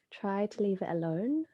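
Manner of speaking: calm, unhurried yoga nidra guidance with long pauses; measured meditation voice, phrases spaced out; guided meditation. Acted. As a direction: guide them inward, leaving space between phrases.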